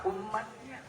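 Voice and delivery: ordinary talk, nothing marked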